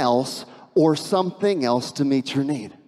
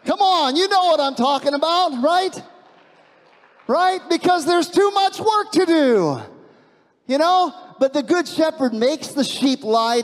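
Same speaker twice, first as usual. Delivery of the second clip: preacher rallying the room; animated appeal; 9 semitones higher than usual. Real, not acted. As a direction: come on, you know this one.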